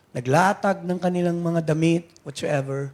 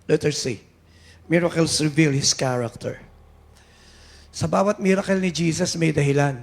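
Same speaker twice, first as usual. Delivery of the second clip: ordinary delivery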